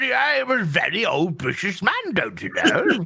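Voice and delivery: mock old British gentleman accent; hammed-up elderly British accent; Gary, old British man. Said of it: "but when the camera's off".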